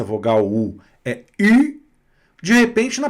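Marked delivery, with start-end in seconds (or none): 1.4-1.8 "I" as French ü, high and loud; an ee through rounded lips on "I", pitched up; French pursed-lip u. Shown as a demonstration. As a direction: pout the lips and push out "I" as a French u. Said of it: "aprender que U".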